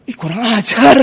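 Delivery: indignant exclamation; retold bristling insult; mock outrage. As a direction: act out an offended spouse. Acted indignation in quarrel.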